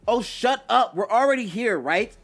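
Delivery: high, loud, brash retort; heated, cocky comeback; yelling back. A narrator's reading. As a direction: snap back at a teammate, shouting. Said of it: "But Naruto yells back".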